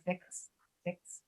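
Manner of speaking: FIX, FIX, soft, ending in voiceless k-s; voiceless consonant demo. Shown as a demonstration. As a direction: let "fix" end on pure air, no voice. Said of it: "consonant, as we do here".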